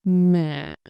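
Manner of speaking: MẸ said as mả, dropping low at the end; dipping tone. Shown as a demonstration. Teacher repeats thanh hỏi example, MẸ as low dipping mả. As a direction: let MẸ dip without rising back. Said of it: "my voice stay low".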